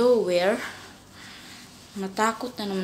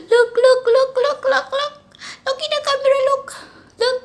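high coaxing; 10 dB louder than usual. Real, not acted. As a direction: coax the cat in a high sing-song. Coaxing cat toward camera.

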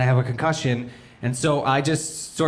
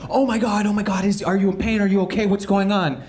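mock-frantic mother, pitched higher; hurried alarmed questions to her crying son; panicked mom; 7 semitones higher than usual. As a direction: mom fussing over her sobbing kid, playfully imitated.

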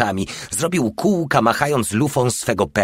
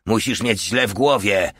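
ordinary delivery, nothing marked

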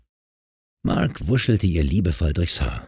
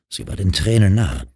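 usual speaking voice

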